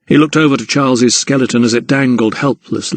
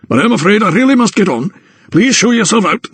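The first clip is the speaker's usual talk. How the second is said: high, hurried brush-off; dismissive anatomist; 10 semitones higher, faster than usual. A narrator's reading. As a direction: get rid of the visitor in a pitched-up voice.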